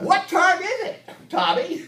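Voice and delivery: high, loud holler; grandpa character. Pretend. Grandpa answering about the airtime, loud high holler. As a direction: shout it high as a clueless grandpa. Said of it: "In your character voices".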